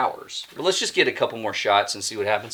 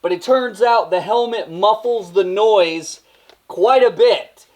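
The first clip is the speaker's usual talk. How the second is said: high, raised voice projected through a helmet; authoritative yelling; 8 semitones higher than usual. Pretend. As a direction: yell it like giving orders to the viewers. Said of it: "I'm instead gonna yell at you".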